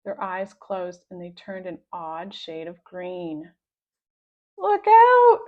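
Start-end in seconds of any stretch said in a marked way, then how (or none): 4.6-5.4 high, loud yell of warning; boy yelling look out; alarmed warning. A narrator's reading. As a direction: yell to warn them. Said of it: "cried Harold".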